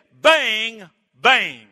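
loud mock gunshots, falling; playful bang bang. Barefoot man squirting the preacher with a water gun, loud. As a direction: shout bang bang like a kid with a toy gun.

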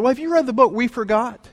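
high-pitched, coyly retold question; playful self-plug. Author retells asking a stranger about his own book, playful plug.

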